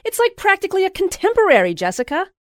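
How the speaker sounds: high, loud, fast excited exclaim; excited shouting. A narrator's reading. Louise on the phone shouting to Jessica, high and loud. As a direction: burst out with giddy excitement. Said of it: "Louise was shouting".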